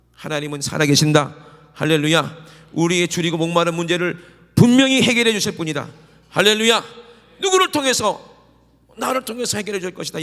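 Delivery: loud, rising revival preaching; fervent preaching. Acted. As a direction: drive the congregation to response. Preaching at full force. (힘차게 외치는 부흥 설교)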